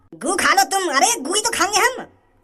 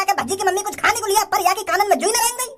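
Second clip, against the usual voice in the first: ordinary delivery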